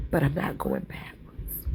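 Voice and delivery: low, slow whispered resolve. Real, not acted. Telling viewers she won't go back, whispered slowly.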